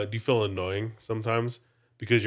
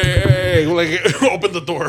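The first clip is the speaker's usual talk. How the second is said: raised, higher mock shout; imitated calling out; 5 semitones higher, 8 dB louder than usual. Friend imitating a man chasing down the van, mock calling out. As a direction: call after a departing vehicle, playfully. Retold chase after the van.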